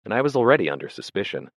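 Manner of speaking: ordinary delivery, nothing marked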